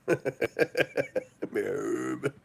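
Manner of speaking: garbled mock word after a laugh; Helen Keller. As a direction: mimic unformed speech while cracking up. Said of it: "didn't quite know how to speak".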